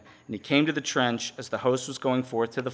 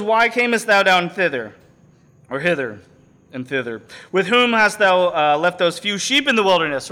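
put-on angry older brother; 4 semitones higher, 6 dB louder than usual. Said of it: "So he's mad, and he goes".

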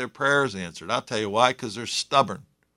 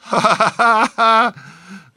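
high, loud burst of laughter; hearty laugh; 7 semitones higher, 5 dB louder than usual. Real, not acted. Preacher tickled by spitting in scripture, hearty laugh. Laughs at the verse just read.

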